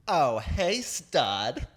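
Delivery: high, loud, playful "Oh, hey, stud", laughing through it; mock the hookup's cheesy come-on, amused; flirty hookup greeting. Imitating the hookup greeting him at the door, amused and flirty. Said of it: "He goes".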